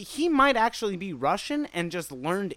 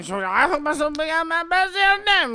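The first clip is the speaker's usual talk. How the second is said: put-on high-pitched garbled Bayou accent; Bayou talk; 9 semitones higher than usual. Joking as a local bass angler, high Bayou accent. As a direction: climb high in a mush-mouthed Bayou voice. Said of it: "that's Bayou talk".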